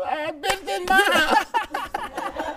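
high, squealing mock outrage, partly laughing; mock outraged quote. Friend retelling the pool scene, mock indignant squeal. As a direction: fake an indignant homeowner shrieking in disbelief.

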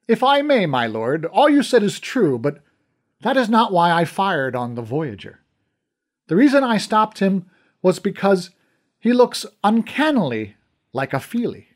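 put-on the old archer explaining himself, respectful but firm; Spitog the archer, earnest. Old archer explains to his lord, lightly voiced and earnest.

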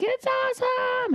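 squeaky, stretched kiddie delight; happy little girls. Retold or imitated. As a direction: imitate a thrilled child, way up high. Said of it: "And they're happy".

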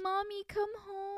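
put-on high little-kid plea; toddler voice. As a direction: ask it like a small child missing mom. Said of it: "he's like".

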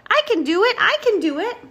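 very high, loud little bulldozer; eager cry. A narrator's reading. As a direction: pipe up as the keen small one. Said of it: "cried Bulldozer".

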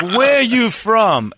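high, loud, very slow imitation Korean accent; mock Korean accent. Man challenging a stranger's origins, mock Korean accent. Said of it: "a Korean man with an accent".

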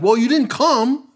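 high, loud, drawn-out excuse; defensive protest. Retold or imitated. Retold excuse to the cops.

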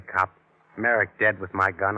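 ordinary talk

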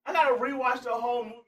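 high, loud, worked-up exclaiming; excited outburst. Real, not acted. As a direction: shout it like a huge discovery. Excited outburst about the film.

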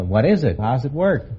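ordinary talk

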